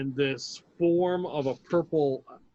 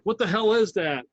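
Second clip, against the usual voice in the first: higher and louder, startled; startled guard; 6 semitones higher, 6 dB louder than usual. Acted. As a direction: blurt it out in alarm.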